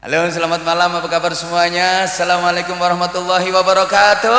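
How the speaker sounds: high, loud stage hello rising to a held note; pop singer greeting. Acted. Preacher greeting the audience in singer's style, high and loud. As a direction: work the crowd like a singer on stage. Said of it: "pakai gayanya penyanyi".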